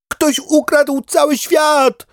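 very high, slow, loud duck alarm; a panicked little duck calling out; duck crying out. Acted. Duck waking to find the world gone, calls out in alarm. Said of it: "zawołała kaczka Katastrofa".

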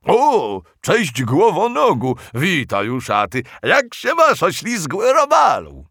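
loud, eager monster greetings; enthusiastic. Acted. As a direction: greet everyone with gusto. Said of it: "z rosnącym entuzjazmem".

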